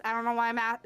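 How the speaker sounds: ordinary talk, nothing marked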